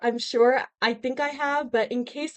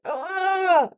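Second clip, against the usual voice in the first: loud, high groan of dismay; exasperated groan; 5 semitones higher, 5 dB louder than usual. Real, not acted. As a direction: let out a pained "ugh". Dismayed at the call-up news.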